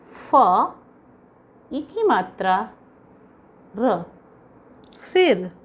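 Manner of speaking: ordinary talk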